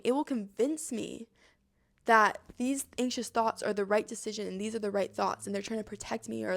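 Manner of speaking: ordinary talk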